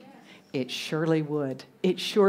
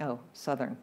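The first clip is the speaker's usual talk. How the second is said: low, hushed "oh, one of them" reaction; mock disdain; 6 semitones lower, 9 dB quieter than usual. Americans reacting to a Southern accent, mock disdain. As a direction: imitate quiet disapproval, dropped low and soft.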